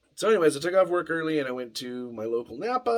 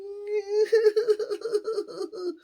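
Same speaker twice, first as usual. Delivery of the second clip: high laugh melting out of the fake sob; sob-laugh burst; crying laugh; 4 dB louder than usual. Real, not acted. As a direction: laughs at his own hammed-up cry. Laughs off own mock crying.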